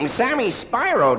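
Spyro said SPY-ro, long i; Sammy Spyro with the long eye sound. Real, not acted. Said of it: "let's not quibble about pronunciation".

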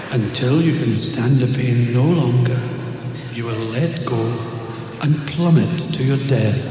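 solemn God answering the man on the cliff. Acted. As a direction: speak as the Almighty from the clouds. God replies.

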